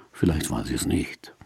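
almost breathe the words; whispered. Real, not acted. Son musing about his mother's spirit, hushed. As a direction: drop to a hush.

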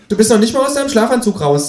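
high, cheeky kangaroo voice. Acted. Reading aloud, the narrator voices the Kangaroo asking him to pay, high and cheeky.

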